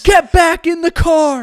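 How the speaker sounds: high, loud, drawn-out yell; screaming officer. Retold or imitated. Officer ordering the driver back into the car, screamed. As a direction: bellow the order slowly at full volume. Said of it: "screams at the top of his lungs".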